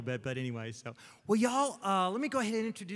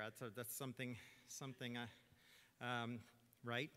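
ordinary delivery

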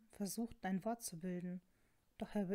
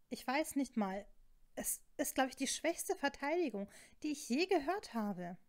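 higher-pitched, indignant retort; worked up and disbelieving; exasperated scorn; 5 semitones higher, 4 dB louder than usual. Acted. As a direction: scoff at a feeble excuse. Acted quarrel, scornful retort.

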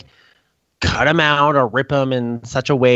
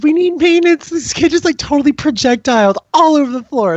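high, louder urgent call; mock frantic call; 4 dB louder than usual. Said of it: "I'm like".

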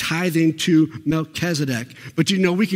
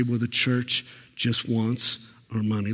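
softer sneering aside; muttered mock complaint; 7 semitones lower, 7 dB quieter than usual. Preacher mimics a churchgoer grumbling about money, quietly. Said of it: "have the audacity to say".

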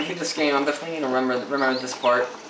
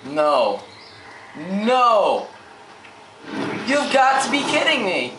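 loud, higher disbelieving shout; exasperated yell; 6 semitones higher, 3 dB louder, slower than usual. Real, not acted. Gamer losing progress again, angry disbelieving shout.

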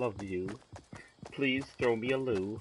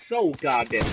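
ordinary talk

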